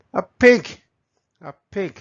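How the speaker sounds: ordinary talk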